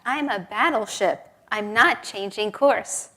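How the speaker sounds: loud, emphatic mock indignation; infuriated captain. Navy captain signals back to the other ship, acted infuriated. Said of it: "Infuriating, infuriated, the captain sends back".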